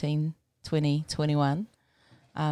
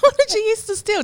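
high surprised question, louder; surprised, delighted; 9 dB louder than usual. Real, not acted. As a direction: ask in amused shock. Host's delighted shocked question.

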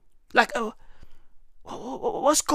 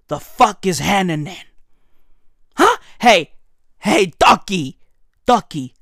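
ordinary delivery

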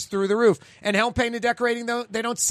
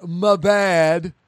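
mocking my bad; 5 dB louder than usual. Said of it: "No more".

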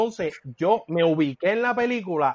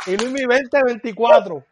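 campaign slogan; 5 semitones higher, 6 dB louder, slower than usual. Pretend. Eslogan de campaña anunciado.

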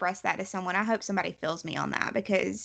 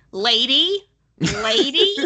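rising, louder mimicked button voice; talking pet button; 5 semitones higher, 6 dB louder, slower than usual. Host mimicking the goose's nagging button, insistent and rising. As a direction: call it out like a repeated button press.

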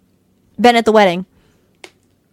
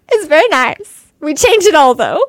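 Franck impression; 6 semitones higher than usual. Franck's favourite line quoted.

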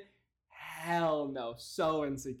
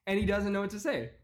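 usual speaking voice

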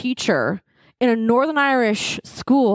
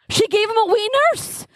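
high-pitched mock outburst; retold exasperated insistence; 11 semitones higher than usual. Retold exasperated answer to friend.

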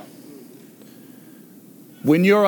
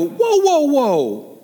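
inner whoa; 10 semitones higher, 3 dB louder, much slower than usual. Retold or imitated. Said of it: "And I stop and I go".

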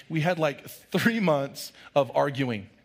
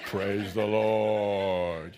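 lower, very slow and lifeless; flat bored hallelujah; 7 semitones lower, much slower than usual. Pretend. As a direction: say it like you couldn't care less. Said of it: "we go".

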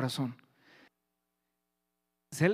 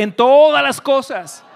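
drawn-out, high-pitched shouted declaration; even louder shout; 9 semitones higher, 16 dB louder, slower than usual. Real, not acted. Preacher urging the congregation on, louder shouted declaration. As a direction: belt it out slowly to rally the crowd. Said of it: "dilo más fuerte".

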